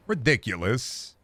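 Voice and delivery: usual speaking voice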